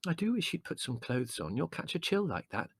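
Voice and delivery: high, soft motherly fussing; mild, absent afterthought; absent-minded. A narrator's reading. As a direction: fuss gently, mind half elsewhere. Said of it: "adding absently".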